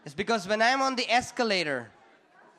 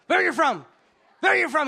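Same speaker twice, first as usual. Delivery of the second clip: louder, higher mock-angry demand; 5 semitones higher, 5 dB louder, faster than usual. Homeless guy demanding where the stranger is from, angry. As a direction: repeat it as an escalating angry challenge. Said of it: "He got so angry".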